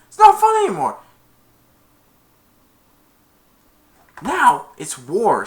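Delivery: hammed-up angry coach; mock fury. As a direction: blow up and declare war. Angry coach declares war.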